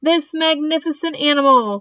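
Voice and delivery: high-pitched, pompous proclamation; loudspeaker announcer. Acted. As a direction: proclaim it grandly to the crowd. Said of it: "continued the loudspeaker".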